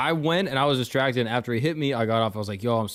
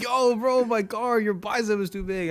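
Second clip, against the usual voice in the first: put-on Mexican accent; 7 semitones higher than usual. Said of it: "Was that your Mexican accent?".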